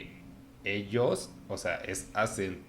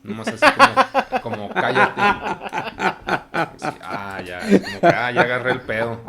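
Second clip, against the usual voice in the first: laughing mockery; 12 semitones higher, 12 dB louder than usual. Mocking the phrase while laughing.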